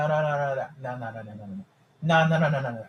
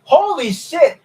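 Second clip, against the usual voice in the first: loud, much higher amazed curse; shocked exclaim; 13 semitones higher, 8 dB louder, slower than usual. Real, not acted. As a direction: let the shock burst out. Reacting to absurd fan claim.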